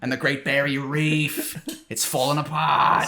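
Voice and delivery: drawn-out Australian wail, hammed up; mock Australian accent. As an Australian lamenting the reef, mock Australian accent. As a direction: stretch the vowels in broad fake Australian. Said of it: "Even Australians, like, kinda just hate".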